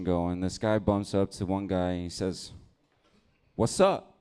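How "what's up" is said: higher, louder street challenge; mock challenge. One guy squaring up to another, higher challenge. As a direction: throw it at him higher as a challenge.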